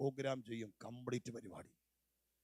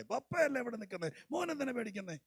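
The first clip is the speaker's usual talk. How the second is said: cooing grandpa tone; 8 semitones higher than usual. Shown as a demonstration. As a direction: coo it like a grandfather soothing a child. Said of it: "അതല്ല അവിടെ പറയുന്നേ".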